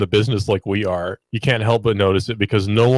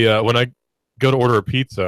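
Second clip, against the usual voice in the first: normal talking voice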